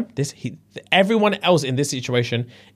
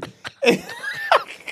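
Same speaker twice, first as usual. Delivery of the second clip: shrieking laugh. Real, not acted. Teller cracks up retelling.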